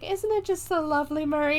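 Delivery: put-on high, sing-song old wife; put-on high voice, sweetly chatty; sweet old lady. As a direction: lilt it high like a cute little old lady.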